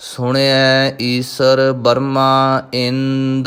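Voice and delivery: slow, measured chanted recitation of gurbani; scripture recitation. Acted. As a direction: recite each line slowly and devoutly. (ਹੌਲੀ ਹੌਲੀ ਗੁਰਬਾਣੀ ਦਾ ਪਾਠ)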